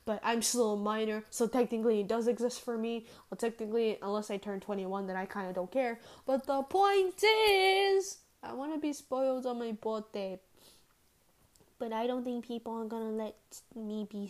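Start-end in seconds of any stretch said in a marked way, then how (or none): 6.3-8.2 but the point is, stretched very slow and high; drawn-out emphasis. Real, not acted. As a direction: drag out the point is as a big emphatic setup.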